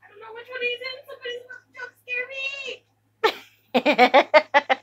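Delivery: high fast nervous rambling; jittery, quick and scared; scared horror game streamer. Real, not acted.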